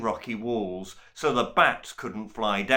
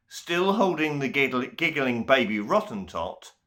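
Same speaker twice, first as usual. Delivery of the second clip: plain reading voice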